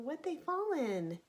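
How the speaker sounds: ordinary delivery, nothing marked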